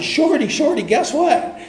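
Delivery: excited calling out. Retold or imitated.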